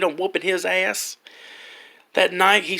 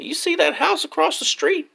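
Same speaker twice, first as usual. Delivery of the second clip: put-on old lady voice; 10 semitones higher than usual. Old lady pointing out house.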